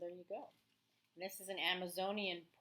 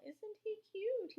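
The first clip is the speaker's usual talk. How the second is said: much higher, slow, cooing over something tiny; 4 dB louder, slower than usual. Real, not acted. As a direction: gush over the little frog. Teacher coos over tiny frog.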